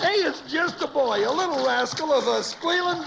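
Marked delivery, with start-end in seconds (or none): none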